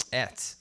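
ET rhyming with "bet"; low, soft "et"; British ate. Shown as a demonstration. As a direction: say ate as a short "et". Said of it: "I says et".

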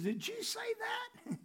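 very high, slow, small voice; startled burglar. Retold or imitated. Burglar questions a parrot in a joke, high hushed disbelief. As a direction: squeak it out softly in mock disbelief. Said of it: "looked at the parrot and says".

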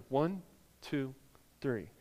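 ordinary talk

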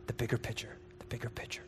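fading into a whisper. Shown as a demonstration. Preacher fading the phrase down to a whisper. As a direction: let it die to a breath.

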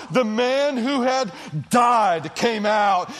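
usual speaking voice

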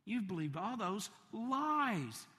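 ordinary delivery

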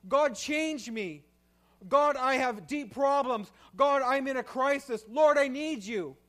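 loud, high, desperate pleading prayer; crying out. Pretend. Worshipper crying out to God for mercy, loud desperate plea. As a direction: beg God from the gut. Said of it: "wants to cry out".

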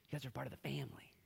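fast, hushed whisper; intimate whisper. Acted. Jesus reminding the disciples they belong, whisper. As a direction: whisper it as a warm secret.

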